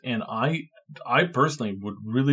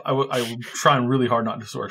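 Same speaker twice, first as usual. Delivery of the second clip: ordinary delivery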